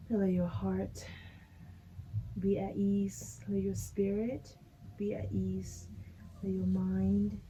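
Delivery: guided meditation. Acted. Guided relaxation cues.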